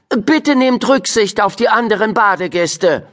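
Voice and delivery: high, firm teacherly warning; stern teacher. A narrator's reading. Teacher warning her class at the pool, high and stern. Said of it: "sagte sie mit strenger Miene".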